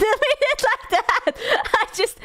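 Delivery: high, giggly embarrassed laugh through the words. Real, not acted. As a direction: laugh off your own awkwardness. Embarrassed after sounding parasocial.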